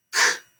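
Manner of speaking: K-O-F said sound by sound, each drawn out; segmenting sounds slowly; sounds stretched apart. Shown as a demonstration. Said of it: "Now I'm gonna stretch it".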